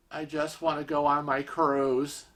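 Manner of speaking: very soft, dazed little plea; meek quiet. Retold or imitated. Husband pleading softly after stranger screams at him, meek. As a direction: say it small, as if defeated.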